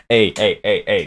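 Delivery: ordinary talk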